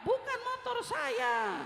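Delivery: high, drawn-out mock protest; raging man imitated. Angry man correcting the driver, mock indignant protest. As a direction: stretch it out in exaggerated indignation. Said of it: "Ngamuk-ngamuk dia".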